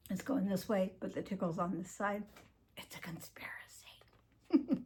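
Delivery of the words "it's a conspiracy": conspiratorial whisper; whispered joke. Real, not acted. Vlogger joking to viewers about choking, a mock-secret whisper. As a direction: hush it like a secret.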